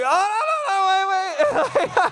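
high, loud retold backpedalling; hammed-up wail of instant regret; mock panic. Preacher regretting his bold healing promise, mock-panicked cry.

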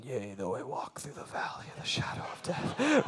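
hushed, whispered recitation; thinking a psalm. Retold or imitated. Walking through a dry riverbed, whispering a psalm to himself. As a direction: whisper it like a private thought. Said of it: "I'm walking thinking".

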